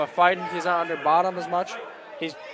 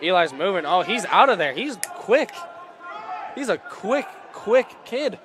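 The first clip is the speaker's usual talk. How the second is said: higher, keyed-up sports call on the move; live wrestling commentary, pitched up with excitement; excited play-by-play; 5 semitones higher than usual. Acted. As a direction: call the action as it happens, eager.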